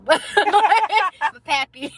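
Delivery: very slow, choking with laughter; drawn-out words cracking into laughs; laughing. Real, not acted. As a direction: squeeze it out through laughter. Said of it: "I almost choked while saying it".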